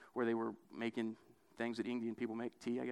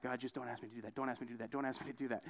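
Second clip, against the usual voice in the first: muttered plea; 4 semitones lower, 8 dB quieter, faster than usual. Retold or imitated. Retold childhood plea to God.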